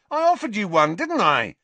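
high, loud defensive protest; shouting. A narrator's reading. Dangling Ron insists he offered to share, shouting. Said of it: "shouted Ron".

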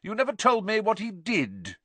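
very high, nosy demand; prying Aunt Marge. A narrator's reading. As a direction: push for the gossip.

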